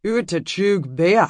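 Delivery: slow foreign words in a Scottish brogue. A narrator's reading. Stranger speaking to Emmy in a Scottish brogue. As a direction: bite out the foreign phrase, slow. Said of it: "His deep brogue bit out the foreign words".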